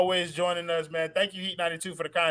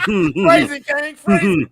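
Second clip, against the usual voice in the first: louder, egging him on; loud urging; 9 dB louder, much slower than usual. Real, not acted. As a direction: push him to say it. Urging a friend to answer.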